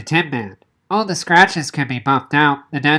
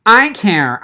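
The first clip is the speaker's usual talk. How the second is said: higher, louder sharp retort; snapping back; 6 semitones higher, 4 dB louder than usual. A narrator's reading. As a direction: fire it straight back, stung. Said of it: "I snapped".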